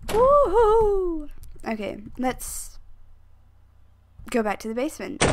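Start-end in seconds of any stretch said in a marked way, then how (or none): 0.1-1.3 high, loud woohoo; whoop. Real, not acted. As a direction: whoop at a narrow escape. Escapes the monster.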